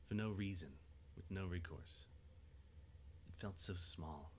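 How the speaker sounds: ordinary talk, nothing marked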